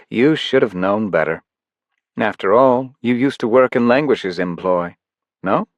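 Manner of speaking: lightly voiced smug ruler; taunting villain. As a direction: Life Force mocking Paige's plan. Life Force taunts Paige.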